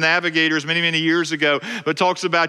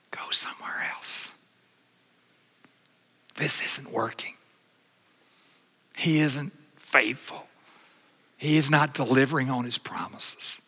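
hushed whisper, sowing doubt; whispering enemy; 6 dB quieter than usual. Acted. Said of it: "the enemy will whisper to you".

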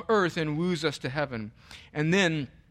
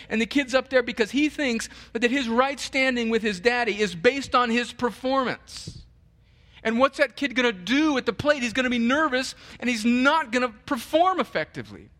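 louder, higher, driving sermon voice; passionate preaching; 8 semitones higher, 4 dB louder than usual. Acted. Pastor driving the point home to his flock, fervent preaching. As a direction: preach it hard to the congregation.

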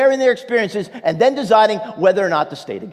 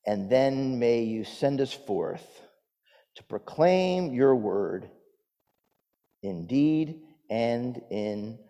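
prayer; 5 semitones lower, 5 dB quieter than usual. Acted. Leads a prayer.

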